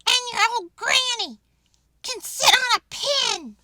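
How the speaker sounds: high, loud insulting yell. Acted. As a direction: fling the insult at the wolf. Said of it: "the third little pig yelled".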